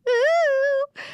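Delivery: a sudden squeal of delight, very high; delighted high ooh. Real, not acted. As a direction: squeal with glee at the track. Excited reaction to a song.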